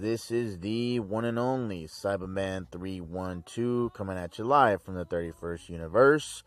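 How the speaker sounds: eerie hammed-up announcer voice; creepy voice. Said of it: "Sorry for the weird, creepy voice".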